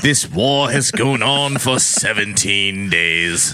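slow, solemn mock Southern narration; Civil War letter-writer. Soldier writing to Marjorie about the war, hammed-up slow and solemn. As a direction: drag it out like a soldier's letter home.

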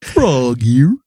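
slow, put-on Kermit voice; Kermit the Frog. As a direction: croak it like the frog puppet. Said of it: "your Kermit the Frog".

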